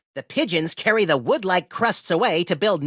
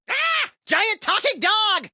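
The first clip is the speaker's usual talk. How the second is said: a very high yelp, then a slower high exclaim; startled shriek; 11 semitones higher, slower than usual. Acted. Max spotting a giant talking dog, shrieking in shock. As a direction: jump out of your skin.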